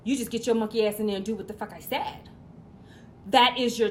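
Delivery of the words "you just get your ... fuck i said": normal talking voice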